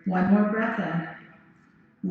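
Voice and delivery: drawn-out, slow and unhurried; slow yoga breathing cue. Shown as a demonstration. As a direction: stretch the words out like a long inhale. Teacher cueing a slow breath.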